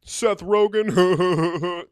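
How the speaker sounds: loud, high, slow gushing over a celebrity; mock starstruck American drawing out Seth Rogen; mock starstruck fan. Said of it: "Americans were like".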